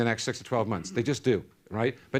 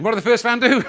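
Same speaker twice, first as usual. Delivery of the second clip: more American; 7 semitones higher, 6 dB louder than usual. Shown as a demonstration. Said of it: "bit more American".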